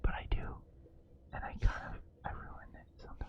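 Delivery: hushed whisper through the tense scene. Real, not acted. Streamer whispers to chat during an intense scene, hushed. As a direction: keep it breathy and hushed, barely voiced.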